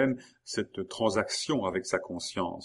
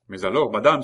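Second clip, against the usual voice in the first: loud, agitated protest; crying out; 2 semitones higher, 10 dB louder, faster than usual. A narrator's reading. Maurice protests to Geneviève, crying out. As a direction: burst out at her. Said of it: "s'écria Maurice".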